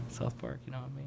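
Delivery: normal talking voice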